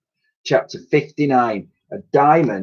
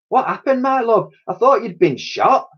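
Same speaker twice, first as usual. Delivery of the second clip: much higher, caring lady voice; Dotty's voice; 12 semitones higher than usual. A narrator's reading. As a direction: voice her like a worried sweetheart. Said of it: "she replied".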